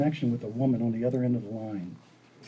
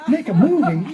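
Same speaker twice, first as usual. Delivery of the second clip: loud, high incredulous laugh; laughing disbelief; 7 semitones higher, 7 dB louder than usual. Retold or imitated. To his filmmaker friend, loudly laughing disbelief. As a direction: scoff through a laugh at the idea.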